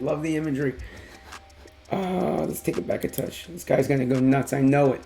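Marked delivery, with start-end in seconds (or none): none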